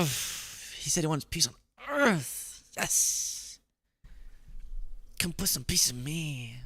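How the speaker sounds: soft, slow, hammed-up rapture; mock swooning. Host pretending to be a smitten Trump voter, mock swooning. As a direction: swoon over it in a half-whisper.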